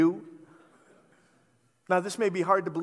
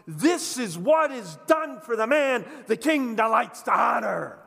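proclaiming shout; 4 semitones higher, 6 dB louder than usual. Retold or imitated. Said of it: "he's gonna shout at the top of his lungs".

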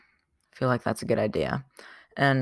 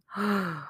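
mock gasp; 8 semitones higher, 5 dB quieter than usual. Dramatic gasp after discovery quote.

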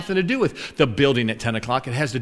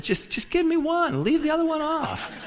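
high, soft, strained plea; squeaky mock entreaty; mock pleading; 13 semitones higher, 5 dB quieter than usual. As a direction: beg in comic exasperation, pitched way up. Teasing plea about doubled y'all.

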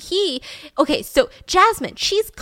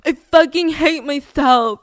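slow, louder, half laughing half crying; mock the despair through the giggles; laugh-crying quote; 3 semitones higher, 7 dB louder, slower than usual. Suspect alone in the interview room, in a laugh-broken mock despair. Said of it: "And he says".

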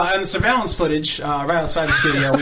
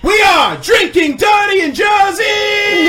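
slow, stretched, much higher and louder; drawn-out announcer shout; 13 semitones higher, 7 dB louder, much slower than usual. Acted. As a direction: belt out the show name slowly. Show title shouted as finale.

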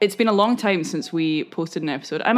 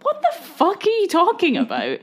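high, pitched-up mock indignation; retold outrage; 10 semitones higher than usual. Daughter hearing mum call her scruffy, high mock outrage. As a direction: replay the shock of being called scruffy, high and outraged. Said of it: "I was so upset".

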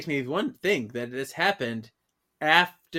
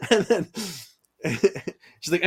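ordinary talk, nothing marked

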